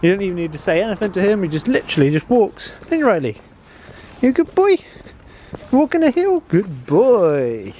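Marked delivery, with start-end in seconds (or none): 2.9-7.7 much higher, louder sing-song praise to the dog; doggy praise voice. Real, not acted.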